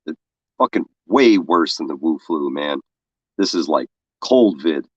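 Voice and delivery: normal talking voice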